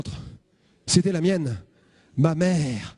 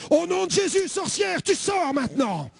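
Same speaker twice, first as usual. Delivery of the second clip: much higher, commanding cry; exorcist command; 8 semitones higher, slower than usual. Retold or imitated. Young convert casting out the sorceress, commanding cry. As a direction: cast her out with full force. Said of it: "C'est peut-être ça qu'il faut faire".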